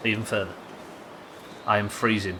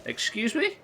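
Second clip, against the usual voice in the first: surprised; slower than usual. Real, not acted. Shocked by cache code request.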